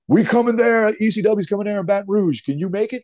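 put-on heavy Bronx accent. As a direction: shout it in a thick Bronx accent. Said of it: "with that heavy Bronx accent".

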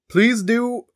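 high, short-lived British attempt; mock-British, pitched high; attempted British accent. Shown as a demonstration. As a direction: start out in put-on British. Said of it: "I can't do accents".

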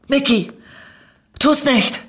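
hoher, lauter, flehender Warnruf; warnender Ausruf. A narrator's reading. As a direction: sie mit einem Aufschrei aufhalten. Vermummte Lorna ruft Niki warnend zu. (alarmierter, hoher Zuruf)

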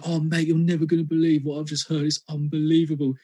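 quick London teenager voice, lightly put on; classic London accent. Young Londoner telling a mate about tunes, London accent. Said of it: "classic London kind of accent when I was younger".

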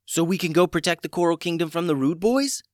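high, eager question; keen to charge into action; excited. A narrator's reading. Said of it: "excited now to take action".

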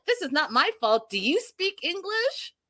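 high, incredulous rising question; mock disbelief, pitch climbing to the end; indignant retort. Host imitating husband snapping back at wife, incredulous. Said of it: "Stacy's yelling at Florian".

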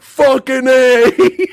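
loud, high, slowly stretched whoop; hammed-up action-hero jubilation; mock triumphant cheer. Host imitates Snake in the Panzer, mock triumphant cheer.

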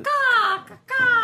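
high, loud mock crow caw; crow call. Co-host cawing to cover a late guest's entrance, loud crow call. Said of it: "Isn't that what a crow sounds like?".

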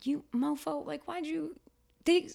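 high-pitched, incredulous griping; exasperated retort. Retold or imitated. Actor to an underqualified fellow auditioner, exasperated retort.

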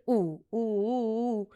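acted-out long oohs; drawn-out oohs. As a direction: stretch each ooh out in mock reaction. Acting out her stunned, offended reaction.

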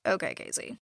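sarcastic aside. Retold or imitated. Host dismissing Casey's self-pity, dry quiet sarcasm. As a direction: say it like you don't buy a word.

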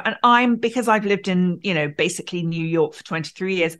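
ordinary talk, nothing marked

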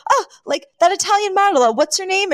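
high-pitched imitation of eager reporters; excited journalists. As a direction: pitch it up like press spotting a model. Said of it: "and they're like".

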